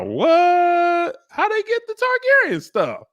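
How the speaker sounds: high, loud incredulous what; baffled at the costume; real disbelief. Real, not acted. Reacting to the crest, incredulous and high.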